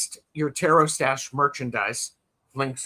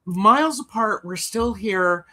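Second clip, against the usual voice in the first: high mock sentimental; 6 semitones higher than usual. Echoes partner, higher mock sentiment.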